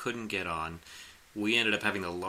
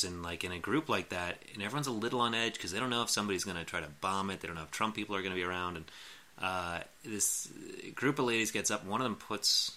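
usual speaking voice

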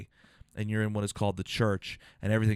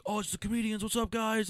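mock fan greeting; 11 semitones higher, 4 dB quieter than usual. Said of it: "and they're like".

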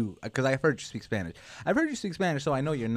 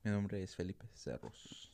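Spanish pronunciation; 4 semitones lower, 12 dB quieter than usual. Shown as a demonstration. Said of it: "You say".

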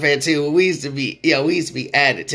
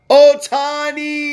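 hype shout; 13 semitones higher, 6 dB louder, much slower than usual. Acted. Sports talk host hyping player.

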